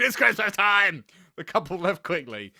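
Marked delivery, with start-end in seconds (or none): 0.0-1.0 louder, slow hammed-up yell; mock scream. A narrator's reading. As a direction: drag it out like a crazed Santa. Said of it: "he screamed".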